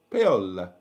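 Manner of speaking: attempted Italian accent, falling low; Italian-accented name, dropping in pitch; best Italian accent. Shown as a demonstration. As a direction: say "Paola" as Italian as you can. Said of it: "best Italian accent".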